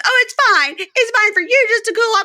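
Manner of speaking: put-on high, loud indignant girlfriend; mock furious rant. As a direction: act out a girlfriend who is fed up. Retold girlfriend quarrel.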